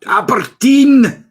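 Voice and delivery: loud, high, drawn-out mock Scottish accent; bad Scottish accent. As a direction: belt it out slowly in a wildly overdone Scottish accent. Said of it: "try and do that Scottish accent".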